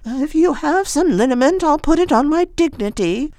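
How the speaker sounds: high, lightly voiced old woman. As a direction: chirp it like an eccentric old lady.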